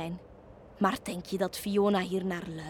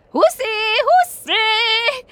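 very high, loud rising whoops; the witch loops her broom over the sea, squealing with glee; gleeful whoops; 13 semitones higher, 10 dB louder, much slower than usual. A narrator's reading. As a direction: swoop and squeal like a giddy child.

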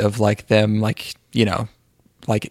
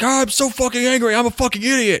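much higher cartoonish rant, mocking a dumb guy; high, silly straw-man voice spitting it out; 11 semitones higher than usual. Straw-man guy in a political comic rants, high mock voice. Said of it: "and the guy is like".

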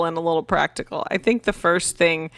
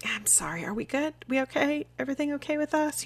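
vague apology; 8 semitones higher, 5 dB quieter than usual. Retold or imitated. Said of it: "I'm just gonna be like".

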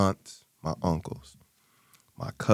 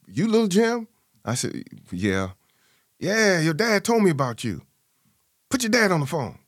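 lightly voiced friend; 9 semitones higher than usual. Father's army friend on the phone.

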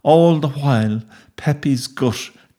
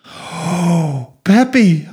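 high, stricken cry; distraught cry; 5 semitones higher, much slower than usual. A narrator's reading. Boy over his motionless dog, crying out in anguish. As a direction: cry out high to your hurt pet. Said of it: "I cried".

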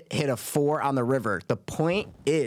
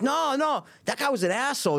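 players reassuring; 10 semitones higher than usual. Retold or imitated. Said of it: "They're like".

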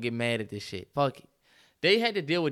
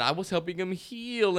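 mocking Jada; 4 semitones higher, slower than usual. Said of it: "But all of that".

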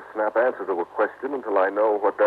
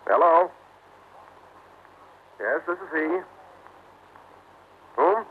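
higher-pitched, polite phone voice; telephone manner; 7 semitones higher than usual. Acted. Husband answering Sir Richard's phone call, raised polite voice. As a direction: lift the pitch for the caller.

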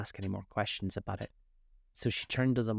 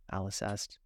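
plain reading voice

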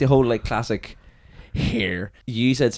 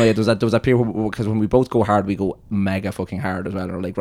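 ordinary talk, nothing marked

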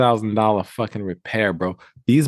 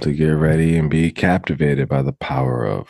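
booming, low announcer voice opening a show; grand announcer intro; 6 semitones lower than usual. Pretend. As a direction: hype the show like a big-stage host. Mock podcast intro announcement.

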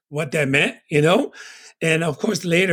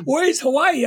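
put-on puzzled kid question; 9 semitones higher than usual. Retold childhood talk with mom.

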